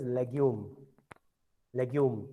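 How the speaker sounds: "legume" said the French way, lay-gyoom; teacher modelling French "legume"; French pronunciation. Shown as a demonstration. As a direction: model "legume" as leg-yoom, falling at the end. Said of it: "how to call this word".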